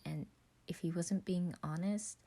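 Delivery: plain reading voice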